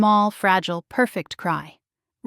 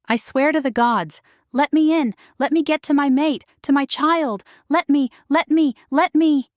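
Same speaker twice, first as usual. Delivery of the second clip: higher, louder frantic begging; desperate pleading; 6 semitones higher, 3 dB louder than usual. A narrator's reading. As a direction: beg to be let in, voice straining. Mate desperate outside barrier.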